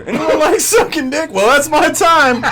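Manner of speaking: high, loud, laughing comic's sign-off; mock stand-up closer. Comic parodies his own stand-up closer for the host, high and laughing. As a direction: ham up a cheesy club comic ending his set.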